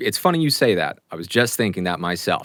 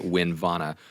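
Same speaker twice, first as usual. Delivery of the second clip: ordinary delivery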